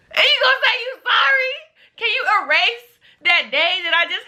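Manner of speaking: high, indignant retold rant; acted high-pitched angry complaint; mad at mom. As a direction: protest it high, wronged and exasperated. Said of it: "I was mad at her".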